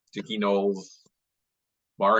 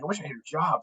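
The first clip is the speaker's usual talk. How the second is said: higher-pitched envious quote; wistful envy; 8 semitones higher, 3 dB quieter, faster than usual. Retold or imitated. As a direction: echo the envy back in a lifted voice. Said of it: "They're like".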